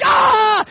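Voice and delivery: loud, high hammed-up yell of fright; mock panicked scream. Preacher acting out disciples freaking out, loud high scream.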